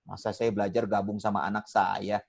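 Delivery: low-pitched, lightly acted objection; reluctant parent. Ustadz acting a parent reluctant to learn beside children, mild protest. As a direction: lightly voice a grumbling parent student.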